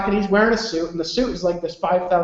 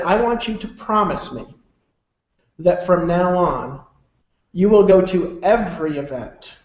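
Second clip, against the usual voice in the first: slow, deliberate repetition with long pauses; retold solemn demand; slower than usual. Mentor pulling him in for a promise, slow and grave. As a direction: drive each phrase home slowly, leaving heavy silences.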